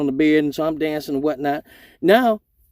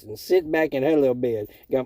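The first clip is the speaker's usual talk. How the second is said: normal talking voice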